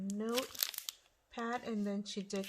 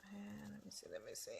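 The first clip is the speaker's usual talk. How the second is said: muttering to self; 13 dB quieter than usual. Real, not acted. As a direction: mumble it to yourself while rummaging. Muttering to herself while searching.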